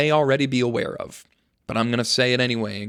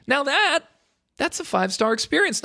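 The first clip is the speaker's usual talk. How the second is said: triumphant. Acted. Triumphant punchline.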